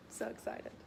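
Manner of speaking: unvoiced hushed thrill; whispered excitement. Real, not acted. Vlogger at work about salad lunch, whispered excitement. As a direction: whisper it so coworkers don't hear.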